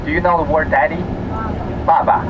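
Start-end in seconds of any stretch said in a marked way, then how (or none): 1.4-2.2 爸爸 said slowly with a higher pitch; careful demo. Shown as a demonstration. Guide comparing the child's word with daddy, careful demo. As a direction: spell out 爸爸 slowly for learners. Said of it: "These words are the same pronunciation".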